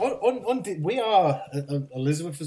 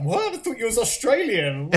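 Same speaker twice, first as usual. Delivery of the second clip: high, astonished retold question; surprised; 9 semitones higher than usual. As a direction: play the baffled listener. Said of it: "And they're like".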